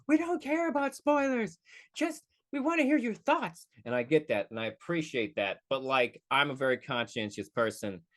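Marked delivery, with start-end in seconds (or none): 0.1-3.7 very high put-on fan voice; high-pitched mock protest; imitating pleading follower. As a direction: mimic a follower's complaint way up high. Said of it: "one loyal follower who always like".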